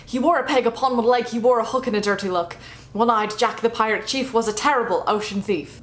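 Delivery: hammed-up pirate accent reciting a poem; mock pirate accent. Reciting the One-Eyed Jack poem in a mock pirate accent. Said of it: "my terrible pirate accent".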